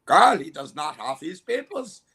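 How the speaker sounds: put-on German-accented officer reporting to his partner. Officer telling Carl about missing papers, German accent. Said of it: "That's a really good German accent".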